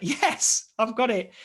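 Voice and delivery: excited yes telling Laura he got the part. Retold or imitated. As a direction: burst out with the good news.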